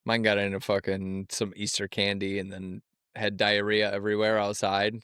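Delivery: normal talking voice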